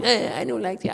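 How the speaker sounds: a teacher mimicking a pupil's whining; mock whine. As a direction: imitate a sulky student grumbling. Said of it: "you whine".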